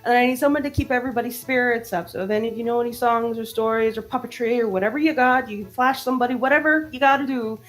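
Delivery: high, fast stream of orders; tiny mouse barking orders. Acted. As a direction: rattle off orders without breathing. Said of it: "barking orders".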